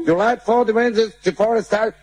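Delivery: high, slow fake English gibberish; hammed-up gringo babble; mock American.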